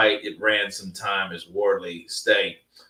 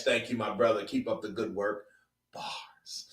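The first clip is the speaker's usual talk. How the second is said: ordinary talk, nothing marked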